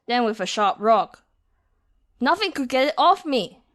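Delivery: ordinary delivery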